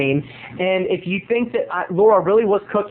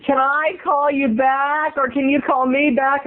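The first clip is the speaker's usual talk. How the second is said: imitating Laura; 7 semitones higher, slower than usual. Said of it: "she's like".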